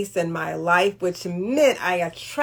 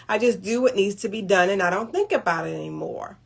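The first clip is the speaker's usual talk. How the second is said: ordinary talk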